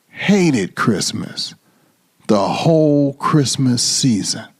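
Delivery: plain reading voice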